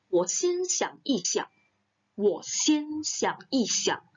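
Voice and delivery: "Wo xian xiang yi xiang" slow, opening high on "wo"; slow model sentence. Shown as a demonstration. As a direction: model it word by word. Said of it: "artinya adalah pikir". (ucapkan "Wo xian xiang yi xiang" pelan)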